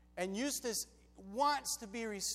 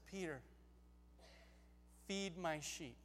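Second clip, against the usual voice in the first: low, soft, unforced command; gentle Jesus; 4 semitones lower, 12 dB quieter than usual. Acted. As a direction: entrust it quietly, with a pause after the name. Jesus gently restores Peter.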